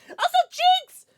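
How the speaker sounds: high, loud callout; calling out. Real, not acted. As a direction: call the name out brightly. Calls out a viewer name.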